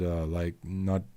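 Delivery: ordinary delivery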